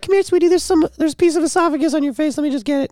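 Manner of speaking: high, coaxing wife imitation; sweet-talking spouse in a lifted voice; doting wife. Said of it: "your wife's just like".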